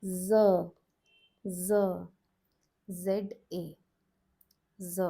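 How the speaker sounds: "Z" repeated slowly and clearly; Z sound demo. Shown as a demonstration. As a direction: sound out "Z" for the class. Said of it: "the letter now changes from J to".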